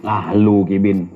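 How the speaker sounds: normal talking voice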